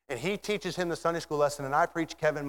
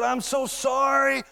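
high, drawn-out acted apology; impassioned apology; 10 semitones higher, 4 dB louder, slower than usual. Jailer apologizing to Paul and Silas, impassioned apology. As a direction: plead it slowly, pitched well up.

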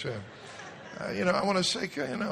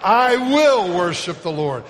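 louder and slower, rising in fervor; loud proclaiming; 5 semitones higher, 10 dB louder than usual. Shown as a demonstration. Pastor rallies congregation, loud fervent declaration. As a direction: belt it out as a declaration. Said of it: "Here we go".

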